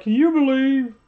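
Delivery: high, whiny mock voice; high whiny imitation of a streamer complaining. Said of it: "He's like".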